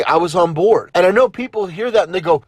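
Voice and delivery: usual speaking voice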